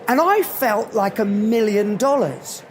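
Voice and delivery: normal talking voice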